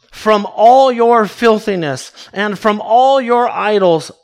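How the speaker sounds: loud, drawn-out stress on "all"; emphatic. Shown as a demonstration. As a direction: lean slowly and firmly on each "all". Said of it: "the language is emphatic".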